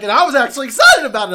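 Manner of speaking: high, loud, laughing through the words; amused disbelief, laughing out loud; laughing at the kid's praise. Retold or imitated. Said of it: "And he's like".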